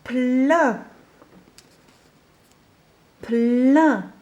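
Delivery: "Plein" said clearly, falling tune; dictation word. Shown as a demonstration. Teacher dictating "Plein" to a listening child. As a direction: pronounce "Plein" crisply for dictation. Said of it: "Écoute bien ce mot".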